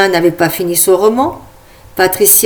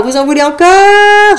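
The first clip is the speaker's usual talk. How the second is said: hyped crowd call; 10 semitones higher, 5 dB louder than usual. Acted. Host asks crowd, first time.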